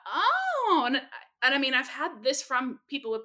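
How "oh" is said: a high cracked oh of mock dismay. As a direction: squeak out a pained oh, half joking. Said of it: "And I went".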